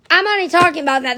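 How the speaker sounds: rapid repeat. Real, not acted.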